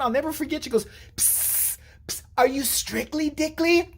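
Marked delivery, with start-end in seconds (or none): 1.2-1.8 quiet attention-getting psst. Retold or imitated. Girl gets stranger's attention. 2.1-3.9 high, slow mock-secretive question; teasing girl. Girl teasing strangers in theater.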